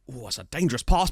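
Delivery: ordinary talk, nothing marked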